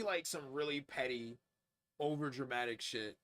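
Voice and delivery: ordinary talk, nothing marked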